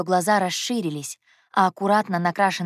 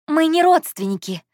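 high, louder insistent denial; hasty reassurance; 9 semitones higher, 5 dB louder than usual. A narrator's reading. Heroine corrects Anton's wrong idea, hasty reassurance. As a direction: set him straight, high and emphatic. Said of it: "Я поспешила его успокоить".

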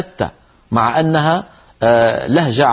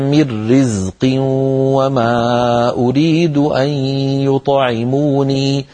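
slow chanted recitation of the verse; Quranic recitation; much slower than usual. Shown as a demonstration. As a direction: recite it slowly, in tajweed style. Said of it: "فلو قرأ القائل على الأصل". (تلاوة مجودة بطيئة)

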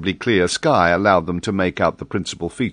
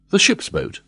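exclaimed; 12 semitones higher than usual. A narrator's reading. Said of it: "exclaimed Glenarvan".

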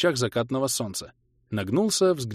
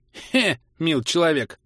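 slow, amused little snort of a hawker; smirking scoff; 7 semitones higher, 4 dB louder, slower than usual. A narrator's reading. Merchant brushing off the hero with a smirk. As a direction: scoff it off with a chuckle. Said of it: "хмыкнул торговец".

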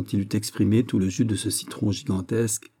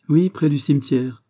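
higher, louder, thoughtless blurt; heedless exclamation; 6 semitones higher, 6 dB louder than usual. A narrator's reading. Porthos answers Aramis about the cemetery, heedless exclamation. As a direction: blurt it out brightly without thinking. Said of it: "s'écria Porthos étourdiment".

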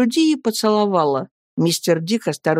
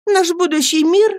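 put-on a higher, earnest young wife's voice; Annie pleading; 11 semitones higher, 4 dB louder than usual. Young wife begging her friends to speak, pleading.

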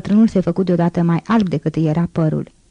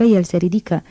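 plain reading voice

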